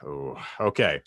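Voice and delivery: ordinary delivery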